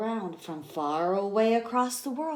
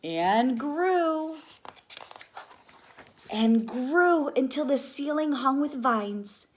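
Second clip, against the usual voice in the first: higher, louder marvelling; growing wonder; 7 semitones higher, 4 dB louder, slower than usual. A narrator's reading. Teacher reading the forest growing, rising wonder. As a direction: build the amazement.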